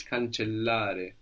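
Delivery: cancellare said slowly with the LL held long; cancellare, lean on the double L. Shown as a demonstration. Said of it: "And two L's".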